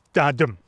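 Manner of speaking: "da-dum" with weak then strong beat; da-dum rhythm. Shown as a demonstration. Teaching students the iamb, da-dum beat. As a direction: tap out "da-dum", stress the dum. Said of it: "an iamb is a single unit of rhythm".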